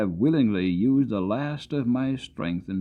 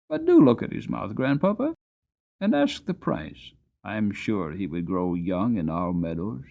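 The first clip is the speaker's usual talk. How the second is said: put-on urging young boy. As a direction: coax grandpa to ask the price. Boy urges grandfather.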